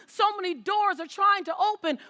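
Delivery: usual speaking voice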